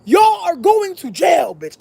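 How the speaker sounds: high, slow, drawn-out holler; big high-pitched proclamation of doom; mock-panicked shout. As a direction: holler it word by word in comic alarm. Retold panic, shouted at friends.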